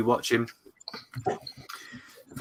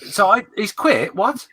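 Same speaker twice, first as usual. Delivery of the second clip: surprised; 10 semitones higher, 5 dB louder, slower than usual. Real, not acted. Shocked at news he quit.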